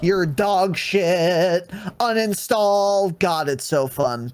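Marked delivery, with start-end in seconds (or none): 0.0-3.2 drawn-out, slow taunts; toxic trash talk. Acted. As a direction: stretch each insult out to gloat. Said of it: "mag dump while you're saying".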